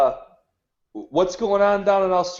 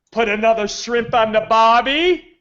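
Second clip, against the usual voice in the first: hammed-up Aussie catchphrase with a rising end; tourist-style Australian accent, lightly done; playful fake Australian; mock Australian accent; 5 semitones higher, 3 dB louder than usual. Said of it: "It's a lovely accent you have".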